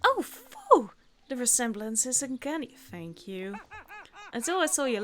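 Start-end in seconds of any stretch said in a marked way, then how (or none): 0.0-0.9 high, loud mock relief; exaggerated sigh of relief; hammed relief. Mike relieved the visitor is only Natalie.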